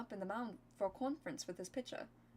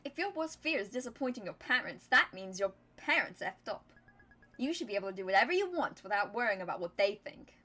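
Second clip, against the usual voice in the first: put-on louder, over-the-top American accent for Min; weird hyper-American accent; 6 dB louder than usual. Min lecturing Dia about parents, in a hammed-up American accent. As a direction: lay the American accent on thick and bold. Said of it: "weird hyper-American accent for her".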